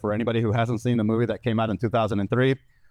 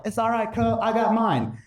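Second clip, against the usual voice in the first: higher, cocky cousin; cousin boasting; 8 semitones higher than usual. Retold or imitated. Cousin reassuring Chico, higher and cocky. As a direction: brag it like the cousin. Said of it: "The grown cousin next to me is like".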